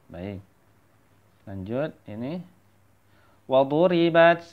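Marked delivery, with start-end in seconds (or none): 3.4-4.4 "waduribat" higher and firm, long du; recitation demo. Shown as a demonstration. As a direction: chant "waduribat" with every syllable clear. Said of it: "seperti itu ya".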